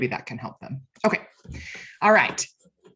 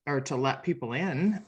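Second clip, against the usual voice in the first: ordinary talk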